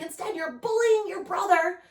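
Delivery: high, loud scolding; mom scolding. Retold or imitated. Mom scolding her son for bullying, high and loud. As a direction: keep yelling, accusing him. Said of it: "I'm like".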